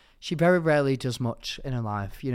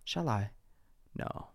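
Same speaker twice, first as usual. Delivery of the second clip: usual speaking voice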